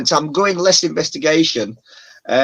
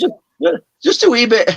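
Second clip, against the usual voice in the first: high, louder mock Scottish accent; 10 semitones higher, 4 dB louder than usual. Shown as a demonstration. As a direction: playfully put on a bad Scottish accent. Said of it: "Have you got a Scottish accent".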